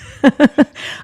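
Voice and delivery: a sudden high, loud laugh at herself; bright laugh. Real, not acted. Mother of five laughing about her busy life, bright laugh.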